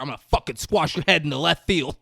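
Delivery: fast, loud laughing threat; mock threat. Gil threatening Tim with a bat, fast and loud. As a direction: growl a threat while laughing. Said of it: "He's like".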